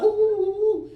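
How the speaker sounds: high, loud excited oohs; excited squeal. Retold or imitated. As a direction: squeal like a kid at the soda machine.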